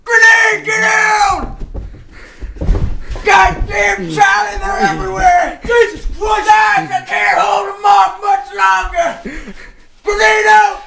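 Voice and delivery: high, loud battlefield shouting; panicked under fire. Acted. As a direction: scream orders while under attack. Acted firefight, soldier under attack.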